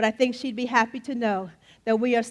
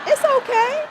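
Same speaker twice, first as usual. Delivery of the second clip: much higher and louder, playful; mock reassurance; 13 semitones higher, 6 dB louder than usual. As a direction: squeak it out teasingly. Teasing reassurance about old age.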